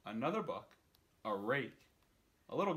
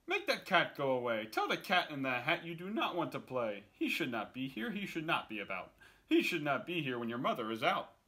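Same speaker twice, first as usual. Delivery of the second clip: anxious fish; 3 semitones higher, 6 dB louder than usual. Acted. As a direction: beg them to send the cat away. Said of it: "But our fish said".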